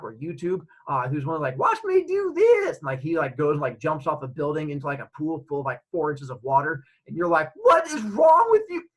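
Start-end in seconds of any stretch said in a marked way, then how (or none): 1.6-2.8 high, slow hammed-up daredevil boast; show-off daredevil. Daredevil to the camera, high slow mock bravado. 7.6-8.9 high, loud, slow disbelieving yell; incredulous shout. Retold or imitated. Viewer yelling at the stunt guy, high loud disbelief.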